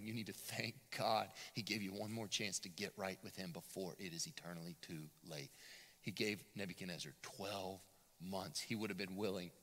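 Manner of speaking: ordinary talk, nothing marked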